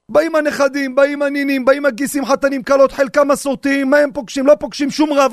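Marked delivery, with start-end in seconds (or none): none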